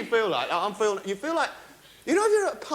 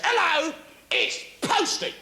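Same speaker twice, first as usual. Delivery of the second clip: postman voice; 7 semitones higher, slower than usual. Retold or imitated. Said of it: "He goes".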